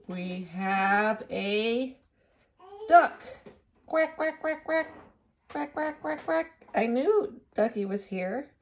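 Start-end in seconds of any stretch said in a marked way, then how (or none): 0.1-3.2 excited reveal. Real, not acted. Showing toy to baby. 3.9-6.5 high playful quack-quacks; duck quacking. Pretend. Imitating duck for baby.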